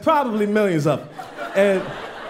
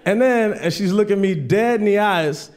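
ordinary talk

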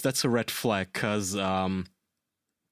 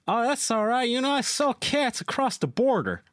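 lightly voiced chatty stranger, higher; imitating chatty stranger; 11 semitones higher than usual. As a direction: retell a stranger's breezy reassurance, pitched up. Quoting friendly stranger on plane.

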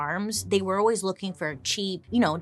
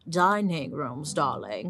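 snooty socialite; 3 semitones lower, slower than usual. Pretend. Mocking rich diners' fashion.

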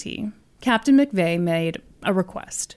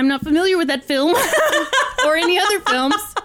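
coy, laughing denial; 10 semitones higher, faster than usual. Real, not acted. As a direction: joke it off while laughing. Joking feigned ignorance.

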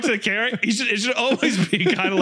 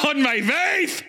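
put-on high-pitched, German-accented dictator rant; Hitler oratory; 6 semitones higher, much slower than usual. Vlogger praising his girlfriend to fans, ranting like a dictator. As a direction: declaim it like a rally speech.

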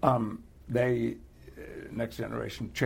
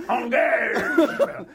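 loud hammy French "Engage!"; French accent; 12 semitones higher, 8 dB louder than usual. Shown as a demonstration. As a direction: bellow it in a comic French accent. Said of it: "that word does lend itself to the French".

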